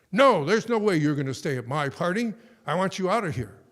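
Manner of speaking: loud, high flat refusal; angry refusal. Retold or imitated. Host refusing to let Bar Kamsa stay, angry loud refusal. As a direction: shut the begging guest down hard.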